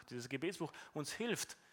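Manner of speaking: ordinary delivery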